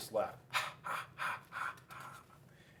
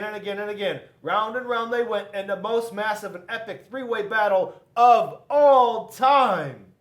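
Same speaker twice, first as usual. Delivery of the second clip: higher, loud, slowing grand finish; epic storytelling; 8 semitones higher, 13 dB louder than usual. A narrator's reading. Narrator describes the endless battle, epic excitement. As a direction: build it up like a legend.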